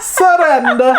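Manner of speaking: high, comic Italian punchline; badly faked high-pitched Italian; Italian accent. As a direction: sing it out as a mock Italian.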